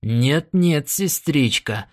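usual speaking voice

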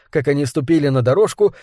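usual speaking voice

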